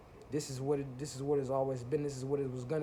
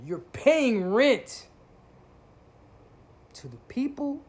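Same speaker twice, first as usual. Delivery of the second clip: much higher, much louder and very slow; outraged shout; 10 semitones higher, 9 dB louder, slower than usual. Real, not acted. Calling out YouTubers who preach war, a slow outraged shout. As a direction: explode in disbelief on every word.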